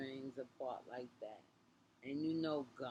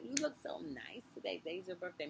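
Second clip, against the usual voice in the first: high-pitched imitation of an admirer; retold gushing compliment; 5 semitones higher than usual.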